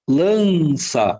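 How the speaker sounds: balança slowly split, with LAN drawn out; syllable by syllable. Shown as a demonstration. Teacher showing the syllables, balança slowly drawn out. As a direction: stretch the nasal syllable in balança. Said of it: "Tá na mesma sílaba?".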